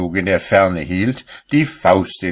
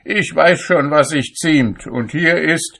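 raised-pitch, self-assured rebuff; huffy retort; 7 semitones higher than usual. A narrator's reading. Cobbler answers Saint Peter's warning, high and huffy.

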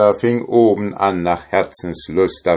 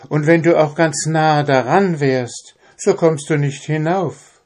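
princess pleading; 9 semitones higher than usual. A narrator's reading. Captive princess begs the drummer for her shirt, high and pleading. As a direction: plead gently like a captive king's daughter.

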